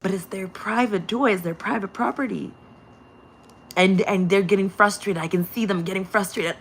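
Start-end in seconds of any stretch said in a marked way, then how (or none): none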